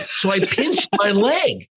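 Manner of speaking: reliving the moment, giddy; excited. Real, not acted. Telling the host how he pinched himself, excited and laughing.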